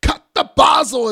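loud, high mock kitchen bark; big, high-pitched imitation of a yelled order; imitating chef's shout. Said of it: "he was like".

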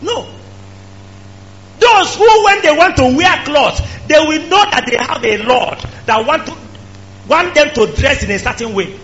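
high, loud, shouted preaching; fiery preacher shouting; full-force preaching. Acted. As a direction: drive it home at the top of your voice.

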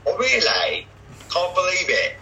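high imitation northern English accent. School friend reacts to an amazing story, northern English accent. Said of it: "he used to do this English accent".

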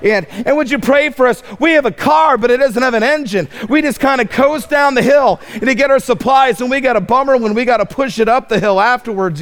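a teasing hint of a begging missionary; mock missionary plea. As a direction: poke fun at a visiting missionary asking for prayer. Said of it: "he would say".

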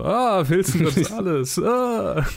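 slow, high, drawn-out recognition; mock recognition. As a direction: act out the gasp of recognition. Audience reacting to actor on screen. (gespielt überraschtes Erkennen)

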